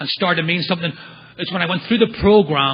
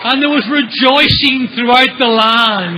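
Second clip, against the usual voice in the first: mock grandeur; 3 semitones higher, 3 dB louder, slower than usual. Mock biblical narration.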